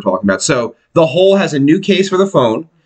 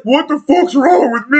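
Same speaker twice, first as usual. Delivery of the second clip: mock voice; 10 semitones higher than usual. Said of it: "And then you're like".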